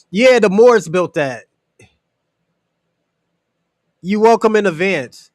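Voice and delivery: booming, triumphant comeback; loud retort. Real, not acted. Host loudly telling the caller the Moors built it. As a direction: slam the point home loud.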